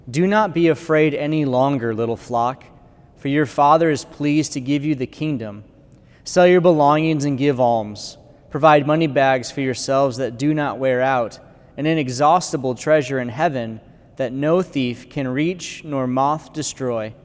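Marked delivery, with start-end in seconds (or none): none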